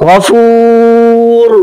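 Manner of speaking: গফুর held very long, lips rounded toward the u at the end; very slow গফুর, final র with a silent rounding of the lips; ishmam stop. Shown as a demonstration. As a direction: draw out গফুর slowly and close on র with lips pursed. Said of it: "আপনি যখন ওয়াকফ করবো".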